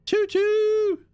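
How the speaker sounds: high, loud mock train whistle; loud train call. Streamer hailing the hype train with a loud high train call. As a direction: shout it like a toy train whistle.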